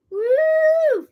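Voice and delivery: a sudden high, loud whoop. Pretend. Playing space alien to her viewers, whoops high and loud. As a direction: whoop it out like a cheer. Jokingly claims alien origin.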